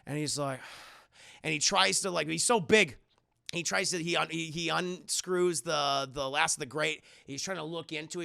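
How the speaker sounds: ordinary talk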